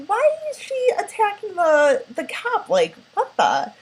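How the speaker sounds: high, incredulous mock disbelief; baffled viewer. Viewer shocked as the girl stabs the cop, high incredulous voice. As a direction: pitch the question way up in disbelief. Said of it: "You're like".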